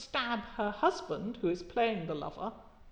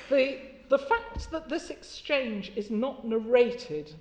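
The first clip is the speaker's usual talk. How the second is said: slow, deliberate lecture stress; stressed lecture point; emphatic and slow; 3 semitones higher, 4 dB louder, slower than usual. Real, not acted. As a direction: spell it out slowly for the class. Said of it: "this is what I want you to think about".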